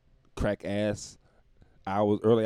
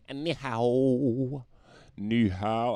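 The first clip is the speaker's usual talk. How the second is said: very slow, higher ni hao; drawn-out greeting; 4 semitones higher, much slower than usual. Pretend. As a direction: stretch the last vowel out long. Drawn-out greeting to Chinese fans.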